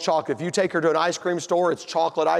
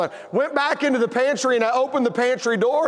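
ordinary delivery

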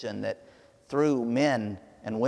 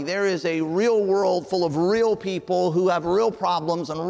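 high, loud, urgent preaching; rising fervent sermon cry; preaching at full force; 8 semitones higher, 5 dB louder than usual. Acted. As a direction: hammer home every real. Preaching climax.

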